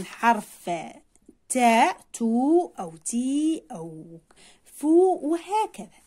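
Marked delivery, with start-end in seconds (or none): 1.5-3.6 slow syllables "تاء", "تو", "تي" with stretched vowels; long vowel demo. Shown as a demonstration. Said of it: "نبحث عن كلمات هكذا". 4.6-5.2 "فو" drawn out slowly as fooo; long vowel demo. Shown as a demonstration. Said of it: "نبحث عن كلمات هكذا".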